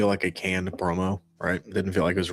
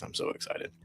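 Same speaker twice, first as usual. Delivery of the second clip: deadpan sarcastic; 14 dB quieter than usual. Real, not acted. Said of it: "As you can tell by my face".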